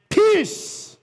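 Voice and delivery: high, forceful cry of "Peace"; commanding shout. Retold or imitated. Preacher voices Jesus's command, commanding shout. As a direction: cry out a sudden command to be still.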